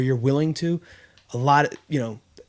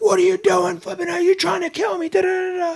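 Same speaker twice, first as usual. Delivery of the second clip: panicked gasping yell. Retold or imitated. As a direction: shriek it like you just came up from drowning. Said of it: "he's all out of breath".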